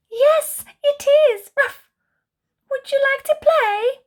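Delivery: high, loud overjoyed puppy; bursting with joy at finding the ball; Puppy gets his ball back, high and thrilled; excited. Acted. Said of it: "asks Puppy excitedly".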